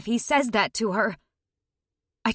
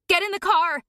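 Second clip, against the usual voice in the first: yell; 8 semitones higher than usual. A narrator's reading. Daughter yelling at her mother to get in the car. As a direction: bark it at mom. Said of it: "I yell".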